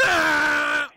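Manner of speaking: loud high yell of "Ah"; hollered out the truck window with no horn; playful loud "Ah"; mock shout out window. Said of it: "sacar la cabeza y gritar".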